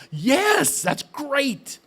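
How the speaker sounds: high, bright-eyed delight; thrilled, way up in pitch; excited. Retold or imitated. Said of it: "And I'm excited".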